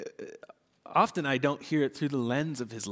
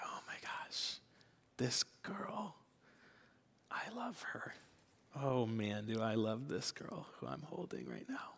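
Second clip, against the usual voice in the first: whisper; 3 semitones lower, 12 dB quieter than usual. Retold or imitated. Rocking sleeping daughter at 2am.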